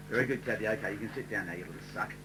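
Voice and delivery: sneaky muttered put-down; muttered aside. Retold or imitated. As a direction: mutter the insult under your breath.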